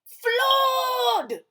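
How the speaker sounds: high, loud alarmed shout. Acted. Mister Blair shouting about the flood upstairs. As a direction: yell out the alarm. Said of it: "shouted Mister Blair".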